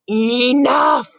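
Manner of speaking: short loud shout; loud growl. Acted. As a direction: bark it like a fed-up dad. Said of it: "Dad growled loudly".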